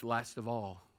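usual speaking voice

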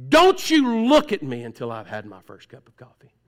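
loud grumpy snap, mock-irritated; grumpy before coffee. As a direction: bark it like a grouch before his coffee. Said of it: "They do it".

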